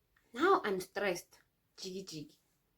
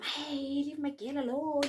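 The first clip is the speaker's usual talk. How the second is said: slower, higher mock tough-boy warning; imitating the boy; 6 semitones higher, 4 dB louder, slower than usual. Boy warning the mom off his girl, imitated. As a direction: play the protective crush.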